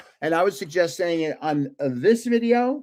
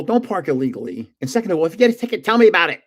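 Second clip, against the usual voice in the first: ordinary delivery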